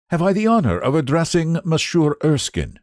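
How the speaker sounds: put-on polite elderly Frenchman with a touch of French accent; a courteous old man with a slight French accent. A narrator's reading. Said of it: "in a slight French accent".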